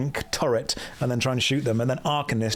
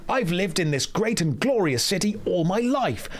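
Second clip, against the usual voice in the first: higher, proud storytelling shopkeeper; higher, hammed-up NPC merchant voice; Kadikarus impression; 5 semitones higher than usual. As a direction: boast about the city like a cheerful merchant. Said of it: "be doing a Kadikarus impression".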